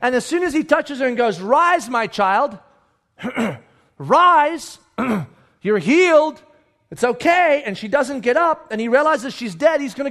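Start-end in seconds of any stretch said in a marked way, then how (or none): 1.4-7.6 slow, drawn-out commanding healer; stretched-out faith-healer pronouncement; hammed-up healer. Mock healer expecting her to rise.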